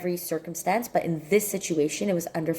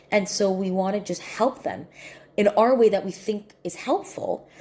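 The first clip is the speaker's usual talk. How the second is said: usual speaking voice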